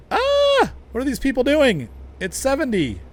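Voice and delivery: high, loud cry at other drivers; mock panicked outburst. Driver yelling at traffic in game, high mock outburst.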